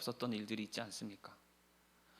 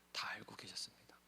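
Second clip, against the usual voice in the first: whisper; 2 semitones lower, 12 dB quieter than usual. Real, not acted. Tender point after Peter's prayer.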